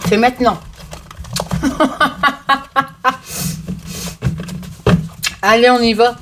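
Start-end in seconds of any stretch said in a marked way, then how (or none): 1.2-5.4 rire aigu. Real, not acted. Rit fort de sa propre blague.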